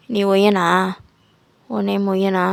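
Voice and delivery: lightly voiced strict professor questioning; stern doctor. Doctor JK confronts the student, lightly voiced stern.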